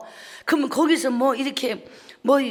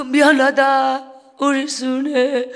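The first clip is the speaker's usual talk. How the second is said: mother's farewell; 8 dB louder, slower than usual. Retold or imitated. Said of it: "당신이 혼자 흘린 눈물".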